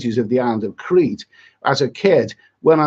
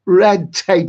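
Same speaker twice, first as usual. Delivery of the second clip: loud fed-up burst on red tape; exasperated red tape; 3 semitones higher, 3 dB louder, slower than usual. Real, not acted. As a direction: spit out red tape in disgust. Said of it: "I've had me rant".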